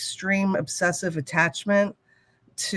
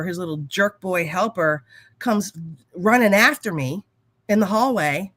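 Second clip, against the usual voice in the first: usual speaking voice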